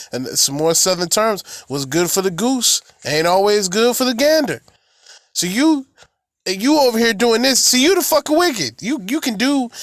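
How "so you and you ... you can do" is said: high, accusing pulpit voice; fiery preaching. Acted. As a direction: call out the wicked from the pulpit. Preacher denounces wickedness.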